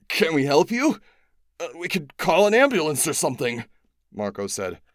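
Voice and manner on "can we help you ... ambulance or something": higher, louder flustered voice; anxious offer. A narrator's reading. Marco offering help to the dying alien, flustered and loud. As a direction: blurt it out in a fluster.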